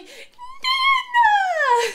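put-on very high, loud child shout; little boy shout. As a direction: yell Nana in delight like a toddler. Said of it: "he's like".

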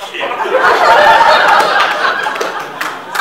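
loud, high burst of laughter; big high laugh. Real, not acted. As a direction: let out a huge laugh.

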